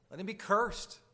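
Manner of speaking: "Let him be cursed" hurled as a warning with cursed peaking high; thundering warning. Acted. Quoting Paul's curse loudly.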